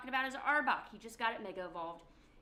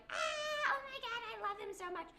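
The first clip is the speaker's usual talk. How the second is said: much higher, squealing swoon; fangirl squeal; 14 semitones higher than usual. Acted. The listener swooning over Corbeau, high squealing gush. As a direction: gush like a starstruck fan, voice way up.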